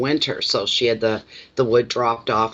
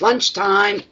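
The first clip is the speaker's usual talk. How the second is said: high sing-song call; calling out lunchtime; 8 semitones higher than usual. Retold or imitated. Old neighbour calling lunch to the men splitting wood, high sing-song call. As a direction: announce it like the boss of the work crew. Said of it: "and she says".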